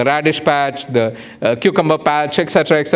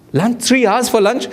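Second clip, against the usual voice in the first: high-pitched disbelieving questions; incredulous echo; 8 semitones higher than usual. Retold or imitated. Diner questioning a three-hour lunch, incredulous. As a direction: echo it back in mock disbelief.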